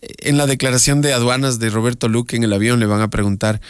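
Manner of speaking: ordinary talk, nothing marked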